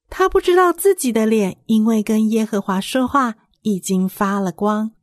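ordinary delivery, nothing marked

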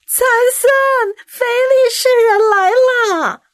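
high, loud alarm call; shouting. A narrator's reading. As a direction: yell out the warning. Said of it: "大利拉又喊道". (大声惊叫)

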